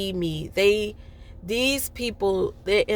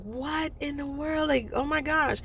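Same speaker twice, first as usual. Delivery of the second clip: amazed; 7 semitones higher, 6 dB quieter than usual. Retold or imitated. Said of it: "I'm like".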